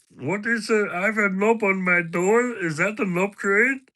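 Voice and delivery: squeaky teasing impression of a friend; Patter's voice. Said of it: "Knob train in Patter's voice".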